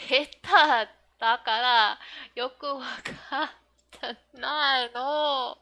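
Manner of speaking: put-on drawn-out loud kid taunt; loud girl line. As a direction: bluntly declare it like a cheeky kid. Reads manga girl's line.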